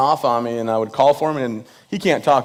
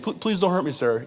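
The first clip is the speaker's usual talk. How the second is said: mock timid stammer, soft and higher; imitating shaky fear; 4 semitones higher, 5 dB quieter than usual. Comic retelling of the trembling father-in-law begging, mock fearful. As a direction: plead like a nervous man, hushed. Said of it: "He's real shaky".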